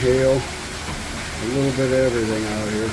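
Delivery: talking loudly over wind and hail; in a hailstorm. Real, not acted.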